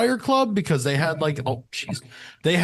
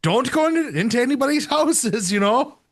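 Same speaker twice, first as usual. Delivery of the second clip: a higher, badly faked Irish accent, scolding lightly; 8 semitones higher than usual. Host keeps the Irishman scolding the devil, bad Irish accent. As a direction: wag a finger at Satan in a bad Irish accent. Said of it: "That's a really bad Irish accent".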